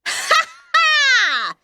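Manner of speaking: high shrieking villain cackle; evil laugh. Acted. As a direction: cackle in triumph. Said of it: "she laughed".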